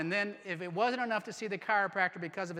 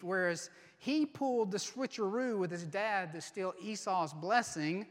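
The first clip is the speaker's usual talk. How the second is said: ordinary talk, nothing marked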